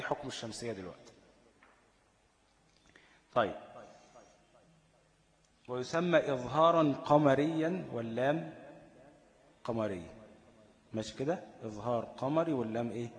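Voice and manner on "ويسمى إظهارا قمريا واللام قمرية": slow, raised reading of the text; formal text reading. Acted. Teacher reading the tajweed text to students, slow and louder. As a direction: read the line out slowly like a recited text.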